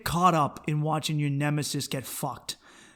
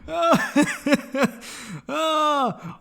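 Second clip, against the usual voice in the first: high laugh breaking into a cry; real laughter; 9 semitones higher than usual. Real, not acted. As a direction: laugh till the voice cracks. Laughing fit.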